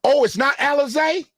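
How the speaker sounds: high-pitched surprised question; oh, caught off guard by a correction. Real, not acted. Surprised at being corrected on name.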